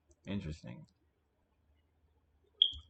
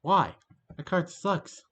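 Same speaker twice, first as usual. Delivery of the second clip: loud, higher, exasperated; angry outburst; 7 semitones higher, 6 dB louder, slower than usual. Real, not acted. Streamer reacting to the opponent's card, loud annoyed outburst. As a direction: blurt it out loudly in disgust.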